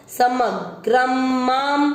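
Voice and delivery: माम with a closing म, not Hindi माँ; careful teacher demo; slow demo. Shown as a demonstration. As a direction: say समग्रम माम slowly, end माम on म. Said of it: "ये माँ नहीं है हिंदी का".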